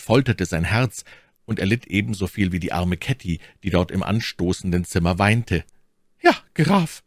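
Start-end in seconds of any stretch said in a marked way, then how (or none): 6.2-7.0 much higher, gentle lady's voice; softest voice. Acted. My Lady greets the supposed count, softest voice. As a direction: coax him tenderly. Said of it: "mit ihrer sanftesten Stimme".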